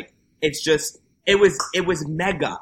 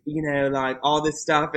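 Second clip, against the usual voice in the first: ordinary talk, nothing marked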